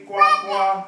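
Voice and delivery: loud frog croaks, Chinese style; Chinese frog sound. Shown as a demonstration. Teacher showing how frogs sound in Chinese, croaking. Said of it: "In Chinese they say".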